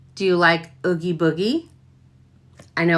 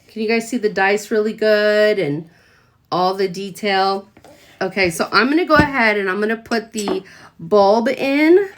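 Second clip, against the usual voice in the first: usual speaking voice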